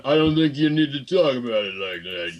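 slurred tough-guy impersonation; hammed-up Italian-American boxer voice; Sylvester Stallone. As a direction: mumble it like a movie palooka. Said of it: "didn't sound like Sylvester Stallone".

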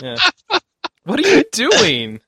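ordinary delivery

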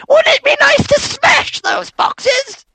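put-on very high, slow shouted kid voice; tempting child. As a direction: squeal it like a mischievous brat egging you on. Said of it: "a child comes running up to you and goes".

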